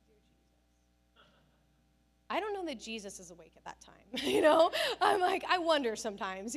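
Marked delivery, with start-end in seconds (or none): 0.1-1.2 breathy whispered aside to heaven; whispered prayer. Acted. Groaning at husband's early rising. 4.1-6.6 laughing through the words, louder; real laughter. Real, not acted. Laughing about husband waking early.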